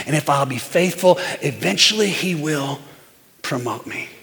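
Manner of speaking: low, softened voice of trust; quiet resolve. Acted. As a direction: settle down into humble conviction. Voices David's humble, trusting resolve.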